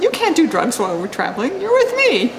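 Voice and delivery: laughing through it. Retold or imitated. Laughing while quoting herself.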